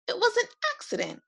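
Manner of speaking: high, fast, lightly voiced boy apologizing; a boy blurting out his apology; sorry little boy. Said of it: "a sorry George said".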